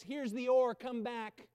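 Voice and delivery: loud, coaxing call to come back; the boat's voice shouting its offer; tempting voice. Acted. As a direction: tempt him back to the boat. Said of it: "that voice that says".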